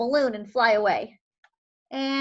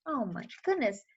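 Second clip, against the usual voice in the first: plain reading voice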